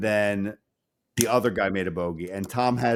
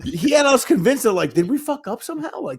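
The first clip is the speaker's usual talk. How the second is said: much higher, disbelieving; mock bewildered; 10 semitones higher, 3 dB louder than usual. Golfer doubting their own score, high and bewildered. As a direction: sound thrown and unsure.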